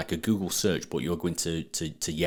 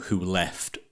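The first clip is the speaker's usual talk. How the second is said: ordinary delivery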